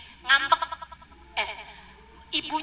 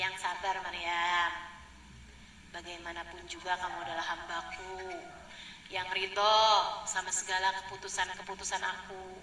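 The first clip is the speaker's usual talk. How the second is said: stern divine voice; 6 semitones lower, 8 dB quieter than usual. Retold or imitated. A lightly voiced God speaking down to Maryam, solemn. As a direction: speak down from above, gravely. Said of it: "misalnya kayak gini".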